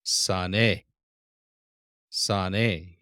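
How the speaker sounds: Sane said slowly and clearly; Sane, teaching model; Hebrew word sane. Shown as a demonstration. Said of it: "You're learning this word".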